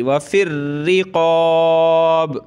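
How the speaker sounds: व फिर रिक़ाब with a doubled hard रा and a thick क़ाफ़; slow recitation of व फिर रिक़ाब, heavy क़ाफ़; hard ra, heavy qaf. Shown as a demonstration. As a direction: chant व फिर रिक़ाब, pressing रा and deepening क़ाफ़. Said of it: "रा को सख्त करेंगे".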